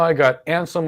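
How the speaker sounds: usual speaking voice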